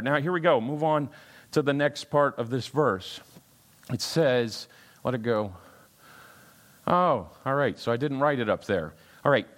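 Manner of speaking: ordinary delivery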